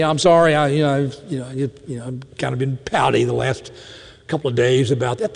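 low, halting, mumbly mock apology; sheepish, trailing-off excuse, acted; half-hearted apology. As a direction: imitate a kid reluctantly fumbling through sorry. Voices child's feeble apology.

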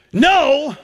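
NO leaping high and firm; a forceful, high-pitched "no" of rejection; emphatic no. Shown as a demonstration. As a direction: punch "no" as a flat-out refusal. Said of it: "gotten that emphatic".

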